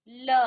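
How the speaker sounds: ल said loud with the tongue lifted, lips still; loud demo la. Shown as a demonstration. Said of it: "ल का उच्चारण किया".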